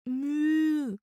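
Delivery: long, high ghostly moan like a cow; moaning moo. A narrator's reading. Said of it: "He let it out slowly".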